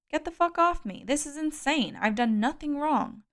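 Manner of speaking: high, loud outraged protest; struggling protest. A narrator's reading. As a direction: protest frantically while held down. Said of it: "I struggled to free myself".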